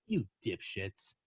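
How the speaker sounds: soft, slow disgusted mutter; dad muttering at his kids; muttered put-down. Retold or imitated. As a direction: drop it low like a fed-up dad. Dad's final disgusted jab.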